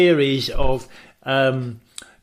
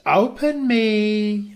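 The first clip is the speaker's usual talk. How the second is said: drawn-out note reading; 7 semitones higher, much slower than usual. Retold or imitated. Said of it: "it says".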